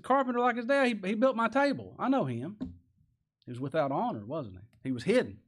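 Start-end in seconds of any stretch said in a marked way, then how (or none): 0.0-2.6 dismissive townsman. Retold or imitated. Townsman dismissing Jesus as carpenter.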